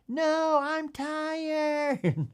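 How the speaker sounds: high, long-drawn mock wail; hammed-up little kid wailing in protest; wailing child. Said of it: "now the kid's wailing".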